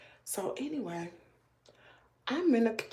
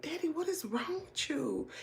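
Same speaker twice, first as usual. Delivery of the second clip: exasperated; 7 semitones higher, 8 dB quieter than usual. Retold or imitated. Retells scolding her father.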